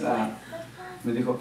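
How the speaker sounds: usual speaking voice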